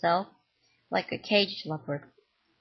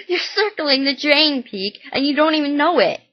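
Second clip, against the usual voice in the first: loud, higher bitter warning; angry scolding; 7 semitones higher, 7 dB louder than usual. Acted. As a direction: hit him with it in anger. Mom scolds son angrily.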